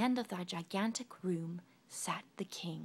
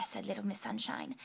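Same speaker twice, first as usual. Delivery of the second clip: ordinary delivery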